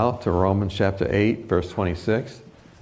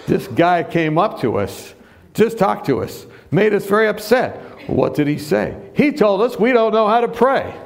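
upset; 8 semitones higher than usual. Retold or imitated. Upset retreat group reports Tony.

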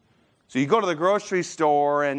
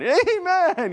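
put-on high, drawn-out little-kid amen; child's proud amen; 13 semitones higher, 4 dB louder than usual. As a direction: sing out a gleeful high amen. Child reciting memory verse in church.

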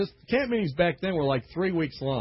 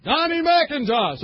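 high, loud, grand proclamation with a slow name; pulpit announcement; 11 semitones higher, 7 dB louder, slower than usual. Retold or imitated. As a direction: announce it to the whole tent. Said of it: "And they go".

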